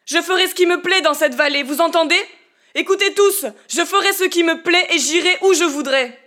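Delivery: loud, high defiant shout; crying out. Acted. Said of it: "Il s'écria". (cri de défi, fort et aigu)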